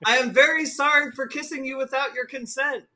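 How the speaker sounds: high, formal, careful apology; earnest Starfire apology. Acted. Starfire apologising to the bartender for the kiss, earnest and formal.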